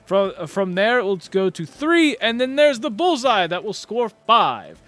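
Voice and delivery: loud, grand tournament announcer voice; booming announcer. Acted. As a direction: proclaim it to the whole crowd.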